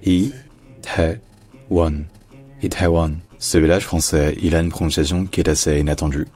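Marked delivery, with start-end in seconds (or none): 0.0-2.0 Itaewon in three slow, separate syllables; very slow Itaewon with pauses between syllables. Shown as a demonstration. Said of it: "c'est juste le son E". 2.6-3.1 Itaewon said whole as "i-tè-won"; three-syllable Korean pronunciation. Shown as a demonstration. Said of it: "c'est juste le son E".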